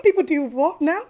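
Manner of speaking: high, stunned question; shocked disbelief. Retold or imitated. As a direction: ask it in horrified surprise. Retold shocked reaction.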